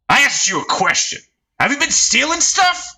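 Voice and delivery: rising fury at his own men; angry interrogation. Acted. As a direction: bark the question at them. Gang leader interrogating.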